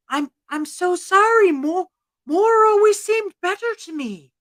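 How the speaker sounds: high, hesitant apology; contrite Sean O'Sullivan. Acted. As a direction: stammer it out, fading to a small worried question. Contrite Sean pleads, fearing dream.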